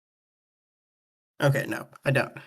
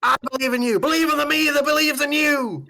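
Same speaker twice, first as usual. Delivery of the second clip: high, loud rallying cry; hammed-up heroic encouragement; impassioned pep talk; 11 semitones higher, 4 dB louder, faster than usual. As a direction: cry it out with total conviction.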